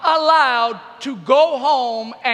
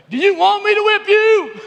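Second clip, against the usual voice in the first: hammed-up parental yell, higher and louder, laughing through it; mock angry parent; 7 semitones higher, 5 dB louder than usual. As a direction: play the furious parent for laughs. Angry parent threat, retold.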